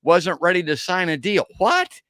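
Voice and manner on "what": incredulous what. Real, not acted. Reacting in disbelief to news.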